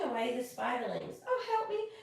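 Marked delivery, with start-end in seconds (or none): 1.3-1.9 high, pleading cry for help; begging. Acted. Blossom pleading with the nuts for help, high begging. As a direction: beg the nuts to save you. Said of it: "she begged".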